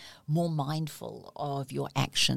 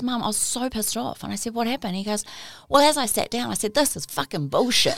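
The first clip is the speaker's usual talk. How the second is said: excited indignant son; 8 semitones higher, 7 dB louder than usual. Retold or imitated. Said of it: "And he goes".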